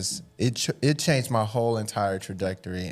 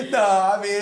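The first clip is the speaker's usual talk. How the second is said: laughing; 13 semitones higher, 3 dB louder than usual. Real, not acted.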